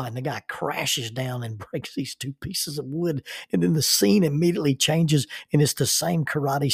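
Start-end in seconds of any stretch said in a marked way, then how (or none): none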